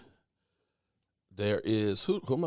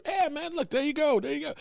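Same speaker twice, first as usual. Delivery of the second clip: much higher, friendly and obliging; eager agreement; 11 semitones higher than usual. Retold or imitated. Football legend agreeing to fan's photo request, eagerly obliging. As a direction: jump up in pitch, happily saying yes. Retells star agreeing to pictures.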